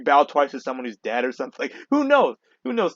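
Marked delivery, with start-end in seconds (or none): 1.6-2.9 high, exasperated who knows; incredulous who knows. Real, not acted. Vlogger shrugging at the world's end, pitched up high. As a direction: throw it up in despair.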